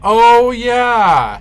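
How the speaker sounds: high, loud sudden oh yeah; excited recognition. Real, not acted. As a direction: burst out as it clicks.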